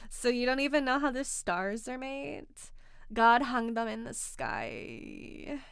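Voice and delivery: exaggerated mean-girl voice, drawn out at the end; mean girl vocal fry. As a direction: mimic a condescending girl with creaky vocal fry. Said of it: "on the voice and the vocal fry".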